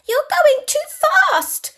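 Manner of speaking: put-on very high, loud kid voice; squealing backseat complaint; child's holler. A narrator's reading. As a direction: holler like a bossy small child. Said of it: "you holler in your car seat throne".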